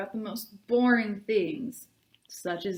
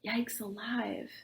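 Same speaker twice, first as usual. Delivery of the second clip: slow, hushed, much softer; soft whisper; 10 dB quieter, slower than usual. Acted. Natalie startled, hushing at something she sees. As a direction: breathe it out, barely there, slow. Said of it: "before saying in a soft whisper".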